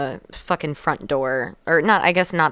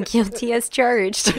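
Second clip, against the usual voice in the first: slow, amused repeat through laughs; laughing echo; 6 semitones higher, slower than usual. Pretend. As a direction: laugh it back at them. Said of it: "echoing the joke".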